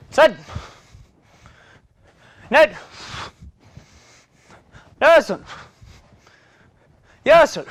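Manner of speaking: loud, punchy count of each rep in Korean; 한 번씩 힘차게 외치는 구령; counting calls. Real, not acted. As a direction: bark out every number to drive the set. Instructor counting out kicks.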